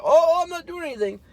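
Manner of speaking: very high squeaky whine of feigned innocence; mocking fake innocence.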